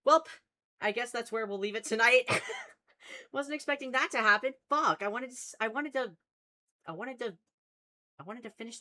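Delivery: loud, high-pitched alarmed exclaiming; real dismayed outburst. Real, not acted. Streamer realizing the game wasn't saved, dismayed outburst. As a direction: blurt it out in shock, half laughing. Game mishap with unsaved progress.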